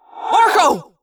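high, loud call of a name; yelled. A narrator's reading. Jake calling to Marco in the fight, high yell. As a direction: shout for him across the dark. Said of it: "I yelled".

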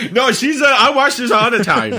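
high, loud boast through laughter; giggly mock-kid bragging; laughing. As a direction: blurt it out while cracking up. Kid bit, breaking into laughter.

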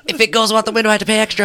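high, loud mock panic; hammed-up frantic holler; retold frantic yell. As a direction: yell it over the wind in a panic. Acted panicked yell in story.

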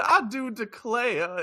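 slow, high, hammed-up Southern belle accent; mock Southern accent. Mocking the actress's Southern accent, hammed-up Southern drawl. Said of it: "her Southern accent was bananas".

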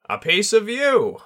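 high, loud squawk of a bird spirit; squawking tengu. A narrator's reading. Tengu squawking at his student over the blade. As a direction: squawk it out like a great crow. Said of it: "the Tengu squawked".

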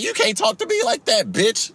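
shrill angry shout; furious high yell. Retold or imitated. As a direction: snap back at her, outraged. Retold shouting back at customer.

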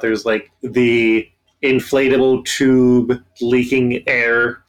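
usual speaking voice

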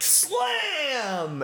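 high, drawn-out slam; high-pitched exclamation; exclaimed game text. Acted. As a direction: stretch it out up high like a hype caption. Reading on-screen sound text.

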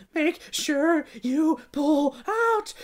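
put-on high, slow breathy girl's plea; gasping girl. A narrator's reading. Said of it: "she gasped".

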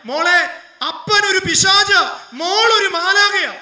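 loud, high and slow mourning cry; father weeping over his daughter's body, loud and slow; anguished cry. Retold or imitated. Grieving father's cry retold.

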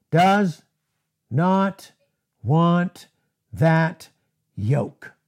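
does, not, want, that, yoke with a pause after each; slow it right down, one word at a time, each one landing. Real, not acted. Preacher to his congregation, driving home each word apart.

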